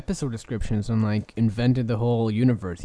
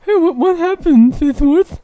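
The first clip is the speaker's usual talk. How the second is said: very high, loud ghost voice; squeaky ghost voice; 10 dB louder than usual. Acted. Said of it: "why did your voice change halfway".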